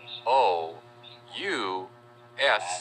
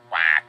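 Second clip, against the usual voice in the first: one loud, low quack; duck quack; 4 semitones lower, 7 dB louder than usual. Shown as a demonstration. Teacher naming the duck, quacking loudly. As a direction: give a single punchy duck quack. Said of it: "Goes the duck".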